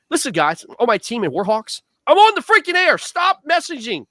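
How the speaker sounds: building to a high, loud yell; exasperated outburst. Acted. Host telling his team to stop messaging him, exasperated. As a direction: yell at the group chat to quit. Mock scolding of constant messagers on air.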